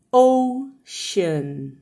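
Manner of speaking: OCEAN stretched into two long syllables; drawn-out O, then a long SHUN; very slow demo. Shown as a demonstration. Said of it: "Eu vou falar devagar agora".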